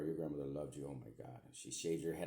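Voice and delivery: ordinary delivery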